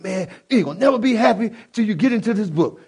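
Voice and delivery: mock-yell it like a nagging big brother; a retold shout of a preaching older brother. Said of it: "He used to always yell at me".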